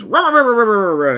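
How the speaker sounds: loud, high growling rah rah rah; mock monster roar. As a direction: roar like a haunt actor. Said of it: "He goes".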